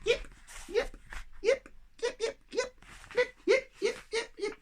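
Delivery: high yappy puppy yips; pugs yipping. Acted. The pugs and echo snow yip back and forth, high yips. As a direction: yip like excited little pugs.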